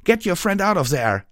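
loud, high urgent command; shouting. A narrator's reading. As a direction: yell the order over the fight. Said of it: "Tailwind shouted from above".

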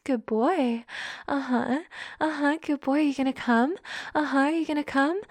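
ordinary talk

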